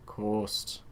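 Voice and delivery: put-on "Coast" drawn out in a Scottish accent, low and soft. Recalling the presenter of the show Coast, imitated Scottish accent. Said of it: "the Scottish person who did the show Coast".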